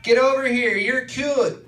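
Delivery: high, drawn-out call across the bar; lady yelling. Retold or imitated. Bar lady calls him over: high mock yell. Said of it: "a lady yell".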